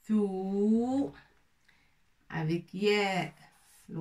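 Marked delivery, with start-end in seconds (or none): 0.1-1.1 thou said with a long rounded u, rising. Shown as a demonstration. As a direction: hold the thou for the class. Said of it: "Pour prononcer". (thou avec un ou long, voix qui monte)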